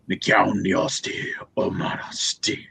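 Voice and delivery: whispered curse, slow and hushed; cursing under breath. Acted. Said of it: "I mutter under my breath in Thasian".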